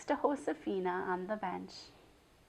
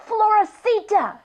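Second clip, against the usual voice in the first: ferocious whisper; 12 semitones higher, 12 dB louder than usual. Acted. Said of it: "she hissed in a ferocious whisper".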